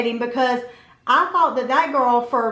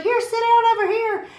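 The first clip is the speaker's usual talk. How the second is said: high-pitched, louder mock hostess voice; imitated hostess; 12 semitones higher, 5 dB louder than usual. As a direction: usher a guest in, pitched way up. Hostess seats unexpected guest.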